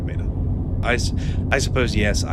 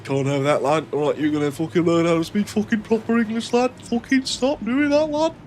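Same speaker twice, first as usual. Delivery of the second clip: mock Mancunian lads; 12 semitones higher than usual. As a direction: ham up a Mancunian friend telling him off. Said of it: "'cause I have a bunch of Mancunian friends from Manchester".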